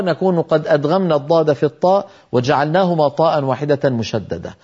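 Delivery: ordinary talk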